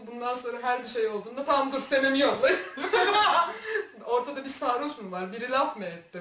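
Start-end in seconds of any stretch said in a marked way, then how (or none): 1.5-2.6 loud, laughing mimicry of friends; laughing mock call. Mimics friends calling her in, laughing. 2.6-4.0 high, long peal of laughter; loud burst of laughter. Real, not acted. Laughs at own story.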